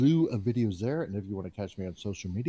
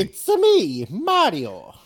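put-on Italian accent; 10 semitones higher, 10 dB louder, much slower than usual. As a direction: ham up a cartoon Italian accent. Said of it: "But in a weird, funny Italian accent".

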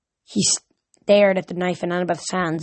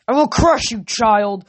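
higher, loud threatening bellow; 3 semitones higher, 4 dB louder, slower than usual. A narrator's reading. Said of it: "Kronos bellowed".

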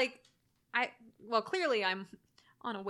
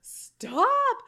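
sudden high, loud cry of Stop; mock scared yell; 14 semitones higher, 11 dB louder than usual. Retelling her fright at the scary scene, loud mock yell. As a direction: yelp it like you're terrified on the couch. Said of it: "I was like".

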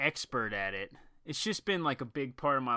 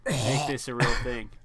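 usual speaking voice

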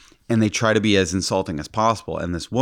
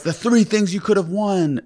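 high-pitched prize pitch; mock excitement; 12 semitones higher, 3 dB louder than usual. Scammers pitching prizes to the winner, mock excited. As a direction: imitate a hyped-up game host, very high.

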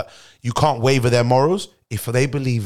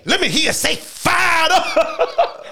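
high, shouted call to the crowd; crowd hype call; much slower than usual. Acted. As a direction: whip up the crowd, laughing. Hype chant call and response.

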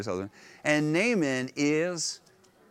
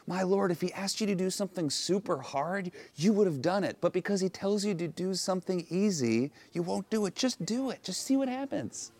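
coaxing servant; 5 semitones higher than usual. Retold or imitated. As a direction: gently talk the proud master round. Servant gently urging her master.

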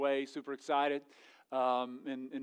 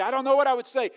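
raised, higher voice of someone caught without an answer; louder acted reply; acted helpless answer; 7 semitones higher, 8 dB louder, faster than usual. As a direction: admit loudly you have no answer. Acts unprepared person answering God.

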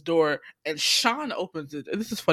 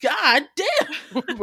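laughing disbelief; 10 semitones higher, 6 dB louder, slower than usual. Retold or imitated. Disbelief at the professor.

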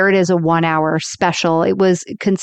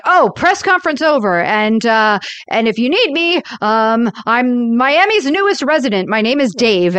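higher-pitched, hammed-up hasty sign-off; flustered diplomat; 5 semitones higher than usual. Retelling the diplomat's escape from the press, flustered mock announcement. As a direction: play an official bailing out of a press conference.

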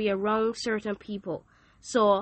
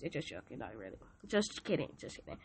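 murmured under the breath, much softer; quiet aside; 10 semitones lower, 12 dB quieter than usual. Real, not acted. Speaker taking back a joke to listeners, hushed aside.

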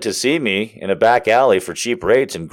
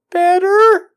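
very high, strained "Better"; mock-timid squeal; unsure squeak; 3 dB louder than usual. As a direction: answer in an uncertain falsetto. Imagined doubtful answer to the man.

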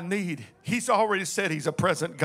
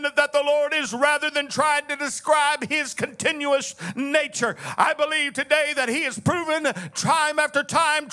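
shouted, higher preacher's cadence with a long Hallelujah; full preaching; 9 semitones higher, 4 dB louder than usual. Acted. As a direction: lift the church with the word. Pastor returns to full preaching.

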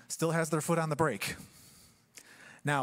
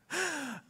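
short laugh; 9 semitones higher, 4 dB quieter than usual. Real, not acted. Preacher laughs at own joke.

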